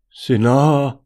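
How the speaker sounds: high, loud astonished blurt. A narrator's reading. Martin sees the naked woman, blurting in astonishment. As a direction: blurt it out in shock. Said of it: "vyhrkl Martin".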